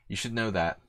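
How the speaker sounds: ordinary talk, nothing marked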